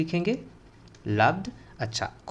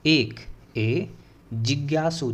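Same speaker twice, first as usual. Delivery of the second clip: ordinary talk, nothing marked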